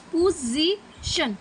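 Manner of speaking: पोजीशन said very slowly, syllable by syllable; teacher drawing out पोजीशन for students; slow word demo. Shown as a demonstration. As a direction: spell out पोजीशन slowly so the stress can be heard. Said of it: "अब देखो शन last वाला है".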